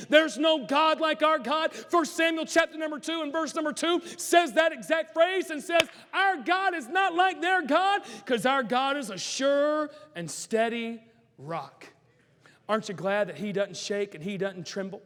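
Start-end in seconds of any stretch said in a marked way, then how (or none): 0.1-11.9 loud, higher preaching shout; preaching at full force. Acted. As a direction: preach it hard to the congregation.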